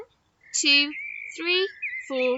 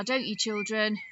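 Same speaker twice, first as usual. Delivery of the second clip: ordinary talk, nothing marked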